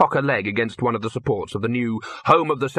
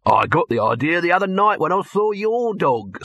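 higher-pitched pitchman voice; brash hustling producer; 7 semitones higher than usual. Acted. Dibbler pitching his idea to Victor, high brash showman voice. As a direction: sell the idea eagerly, pitch riding up.

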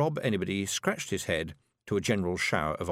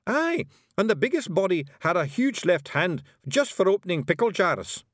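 high, loud wee Scots warrior voice; Scottish Feegle Rob; 9 semitones higher, 4 dB louder than usual. A narrator's reading. Rob Anybody brags to Miss Level, high Scots Feegle. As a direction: play a cocky little Feegle, high and brash.

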